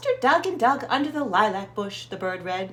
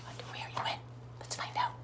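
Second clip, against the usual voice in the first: whisper; 14 dB quieter, much faster than usual. Real, not acted. Reader teases next page.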